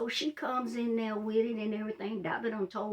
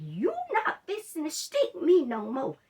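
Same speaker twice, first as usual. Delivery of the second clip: louder and higher, laying down the law to the nurse; firm refusal; 7 semitones higher, 4 dB louder than usual. Retold or imitated. Patient refusing the nurse another needle stick, firm raised voice. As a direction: tell her flatly no more needles. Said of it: "I'm about to lose it in that room".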